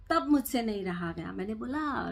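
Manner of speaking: normal talking voice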